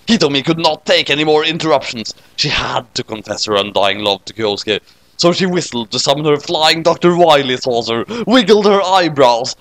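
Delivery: ordinary delivery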